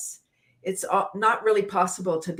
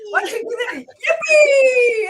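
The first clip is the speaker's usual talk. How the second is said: much higher, shouted yippee; big yippee; 9 dB louder, slower than usual. Shown as a demonstration. Leader leading three yippies, shouting joyfully. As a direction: throw it up like you mean it. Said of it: "like we really mean it".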